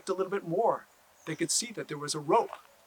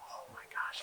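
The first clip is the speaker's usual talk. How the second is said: hushed gasp; 11 dB quieter than usual. Acted. Rope tugs after weeks.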